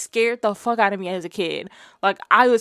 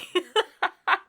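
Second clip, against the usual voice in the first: laugh; 8 semitones higher than usual. Real, not acted. Laughing at her own intro.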